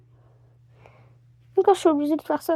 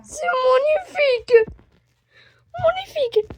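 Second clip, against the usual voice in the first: admiring; 10 semitones higher than usual. Real, not acted. Admiring the dressed-up toy.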